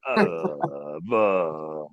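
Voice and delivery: very low, slurred groan of a drugged man; stupor mumble. Retold or imitated. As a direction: drone it out half-conscious from the dentist gas. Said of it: "he is in a complete stupor".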